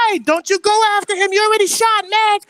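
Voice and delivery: very high, loud mock woman's voice; woman in the corner. Woman in the corner warns him off Tory, high mock yell. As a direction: imitate a woman shouting a warning from the corner. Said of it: "somebody else in the corner, she goes".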